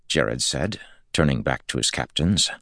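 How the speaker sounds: plain reading voice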